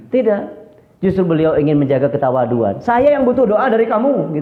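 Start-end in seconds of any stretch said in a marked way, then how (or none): 2.8-4.4 high-pitched retold protest; mock angry intonation. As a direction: imitate an irritated holy man insisting. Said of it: "tapi intonasinya marah". (menirukan nada marah, bernada tinggi)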